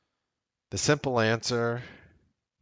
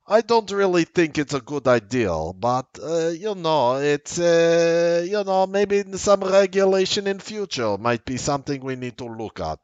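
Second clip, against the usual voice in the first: higher, hesitant mock Russian accent; comic Russian accent; 6 semitones higher than usual. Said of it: "it's just funnier that way".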